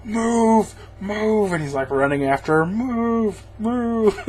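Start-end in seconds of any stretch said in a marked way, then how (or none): 0.0-1.5 high, hammed-up yell of move; mock yell. Dad yelling at his daughter to run, mock high yell. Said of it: "he's, like, yelling at his daughter". 2.7-4.2 high, hammed-up yell of move; mock yell. Dad yelling at his daughter to run, mock high yell. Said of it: "he's, like, yelling at his daughter".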